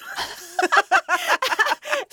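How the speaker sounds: high, loud burst of laughter; big laugh. Real, not acted. Laughing at her mother's quip, a big real laugh.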